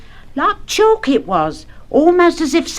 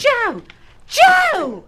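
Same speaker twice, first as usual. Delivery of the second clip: high alarmed call, building; 11 semitones higher than usual. Acted. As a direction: call after him in alarm. Wife calling after him.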